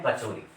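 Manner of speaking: कचौरी with a flat r sound instead of ड़; wrong kachauri. Shown as a demonstration. Teacher showing students the mistaken कचौरी. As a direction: say कचौरी the careless way, r not ड़. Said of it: "ये हम अशुद्ध उच्चारण करते हैं".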